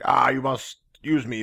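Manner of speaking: normal talking voice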